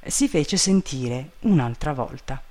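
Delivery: ordinary delivery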